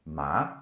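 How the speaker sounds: "Ma" gliding upward like a question; second tone, rising. Shown as a demonstration. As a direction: overpronounce "Ma" with a rising pitch. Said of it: "your voice will go up a bit".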